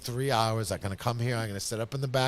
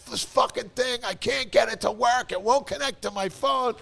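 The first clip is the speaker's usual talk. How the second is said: high, sing-song angry whine; mock frustrated rant; 10 semitones higher than usual. Man ranting to his friend about the printer, high whine. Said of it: "I'm screaming".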